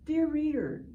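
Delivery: ordinary talk, nothing marked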